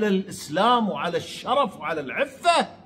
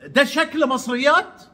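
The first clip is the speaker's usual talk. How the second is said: raised, puzzled question imitating him; mock surprised question; 6 semitones higher than usual. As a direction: echo his astonishment with a rising tune. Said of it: "يعني مستغرب". (سؤال مستغرب بسخرية)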